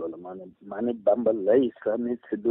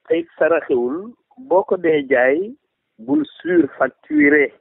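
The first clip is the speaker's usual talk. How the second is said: stretched-out poetic lines, raised in pitch; verse recitation; 7 semitones higher than usual. Acted.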